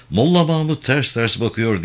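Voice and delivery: plain reading voice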